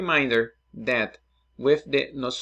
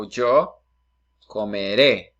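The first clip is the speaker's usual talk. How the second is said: clear model word; 3 semitones lower, 7 dB louder, slower than usual. Shown as a demonstration. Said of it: "the verb is comer".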